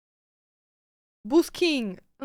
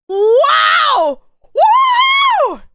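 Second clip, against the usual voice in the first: excited cheer; 12 semitones higher, 4 dB louder, much slower than usual. Real, not acted. Excited shouting.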